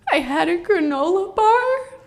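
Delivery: high, mock crying woman's voice; imitated tearful woman. The crying woman answering the leader, mock tearful falsetto. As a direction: squeeze it out in a teary falsetto. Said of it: "And she starts crying".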